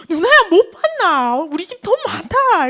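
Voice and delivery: high, loud, drawn-out little-brother voice; younger sibling. Retold or imitated. Little brother challenges his sister about the family money, insistent, high and loud. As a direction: play an insistent kid stretching out the words. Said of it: "내 동생이 뭐라면".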